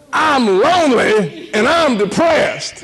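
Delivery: high, loud, slow confession; exaggerated lament. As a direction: ham up David's honest admission. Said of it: "He said".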